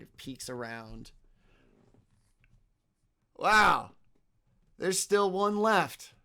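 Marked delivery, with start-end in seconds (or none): 3.4-6.1 loud, raised-pitch disbelief; exclaim, then pronounce it with authority; astonished thief. Acted. Thief spots the last one left, astonished and commanding. Thief reacts to seeing Talarian.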